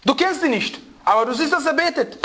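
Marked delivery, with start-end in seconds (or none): none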